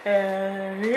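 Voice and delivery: ordinary talk, nothing marked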